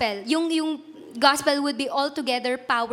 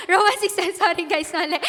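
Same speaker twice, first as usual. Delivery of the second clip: laughing through an apology, higher pitched; real embarrassed laughing; 4 semitones higher, 4 dB louder than usual. Real, not acted. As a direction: giggle over your own slip. Flustered laugh over misquoted verse.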